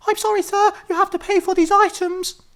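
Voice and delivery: put-on very high squeaky girl; high girly voice.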